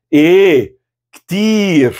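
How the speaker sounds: "E, ktir" in two exclaimed words, KTIR high then falling; exclamation. Shown as a demonstration. Teacher answers the sample question about being busy, exclaiming. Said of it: "e com exclamação".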